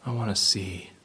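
quiet plea. Acted. Beggar's plea.

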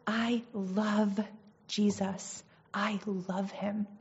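soft, slow, sincere declaration; hushed, earnest confession of love; heartfelt devotion. Real, not acted. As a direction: let each word settle, quietly and tenderly.

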